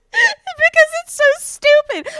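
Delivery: high, drawn-out words through laughter; helpless, squeaky amusement; laughing. Real, not acted. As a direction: barely get it out while cracking up. Cracking up at own impression.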